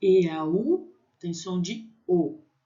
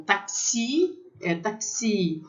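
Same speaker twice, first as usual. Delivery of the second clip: "taxi" with the weight on the final "xi"; French "taxi", stressed on the last syllable; 3 semitones higher, slower than usual. Shown as a demonstration. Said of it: "acentuada no final".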